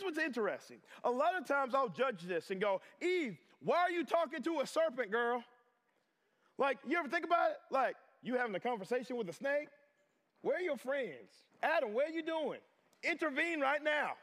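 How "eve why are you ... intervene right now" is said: high, incredulous telling-off; hammed-up exasperation, pitched well up; mock scolding. Onlooker judging Eve and Adam over the serpent, mock exasperation.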